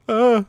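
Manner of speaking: short, high, loud grunt of pain; sudden acted "uh" on impact; victim's grunt. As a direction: cry out as if struck. Victim struck by the villain.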